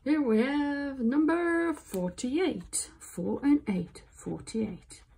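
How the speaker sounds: drawn-out bingo call, numbers spelled out; bingo caller. Pretend. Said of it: "Like a bingo caller".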